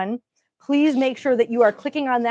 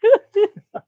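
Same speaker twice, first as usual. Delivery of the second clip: high burst of laughter; 11 semitones higher, 7 dB louder than usual. Real, not acted. Laughing at partner's joke.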